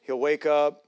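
ordinary talk, nothing marked

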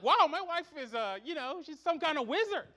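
retold amazement, high and loud; amazed. Husband marvels that his wife's advice worked, amazed. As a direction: marvel out loud, hammed-up awe.

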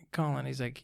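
softly voiced, lightly imitated dad; quiet aside as a dad; mildly confidential dad. Dad talking to his son about drinking, mildly confidential. Voicing dad's confidential talk.

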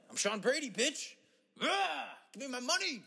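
high, soft, squealed mock demand; put-on high character voice. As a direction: squeal it high and thin, without volume.

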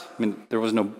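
ordinary talk, nothing marked

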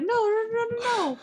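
high, whimpering mock cry; hammed-up tearful protest; mock wail. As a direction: wail it like a sobbing crowd. Everyone reacting, mock crying.

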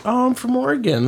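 high-pitched retold reply; chirpy small talk. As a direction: imitate eager tourist chit-chat. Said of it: "I'm like".

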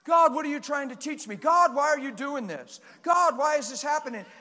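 loud, high, pleading whine; mock the self-pitying believer; whining complaint to God. Preacher imitating a complaining believer, loud whining. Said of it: "here's what you do".

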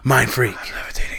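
mock mystic whisper, last words drawn out; hammed-up magician's hushed catchphrase, stretching slowly at the end; Chris Angel. Mocking the street magician levitating, hammed-up mystical whisper.

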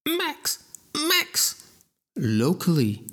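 high, whimpering and near tears; a thug whining as his finger bends; whining in pain. A narrator's reading. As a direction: squeal it out through the pain. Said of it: "producing whining noises from her victim".

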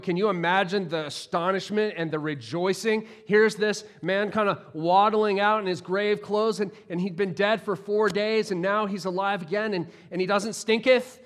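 ordinary talk, nothing marked

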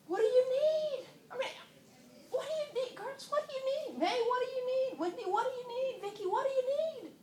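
God asking each woman by name, tenderly. Retold or imitated.

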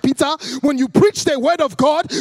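put-on high, loud African-accented mum; African mother's accent. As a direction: imitate an African mother instructing her son. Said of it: "I come from an African Pentecostal home".

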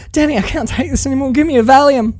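high, hurried mock panic; Marwood freaking out. Quoting Marwood pleading with Danny, high mock panic. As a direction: imitate a panicked plea, rushed and high. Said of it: "when Marwood starts to freak out".

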